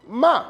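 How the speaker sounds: "ma" high, loud, dropping to an abrupt stop; falling fourth tone. Shown as a demonstration. Teacher showing M with A, "ma" in falling fourth tone. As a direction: throw "ma" down from high and cut it short. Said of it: "vous faites un arrêt brusque".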